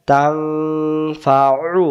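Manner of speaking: তাং held in a long hum, then ফা and উ apart; hidden nun. Shown as a demonstration. Teacher building the word for students, long nasal তাং. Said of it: "এটা গোপন কেন হয়েছে".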